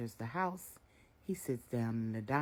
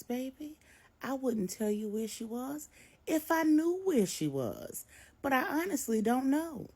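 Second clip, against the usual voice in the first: higher, probing; knowing mother; 12 semitones higher, 6 dB louder than usual. A narrator's reading. Miss Brenda probes her son Marcus, knowing. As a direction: lead him to the answer.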